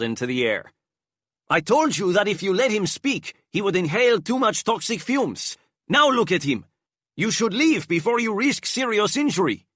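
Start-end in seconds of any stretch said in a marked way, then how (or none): 1.5-9.7 high, put-on scolding voice; disguised scolding. A narrator's reading. Enrique in disguise scolds the guards, high voice.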